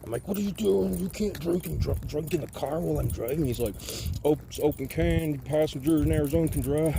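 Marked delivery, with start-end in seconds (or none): 0.3-3.4 high-pitched, mildly indignant objection; re-enacted alarm, pitched up; alarmed protest. Alarmed driver scolding drunk passenger. 4.2-7.0 lightly voiced drunk roughneck, pitched up; higher, slightly louder put-on neighbor voice; neighbor impression. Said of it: "he used to talk like this".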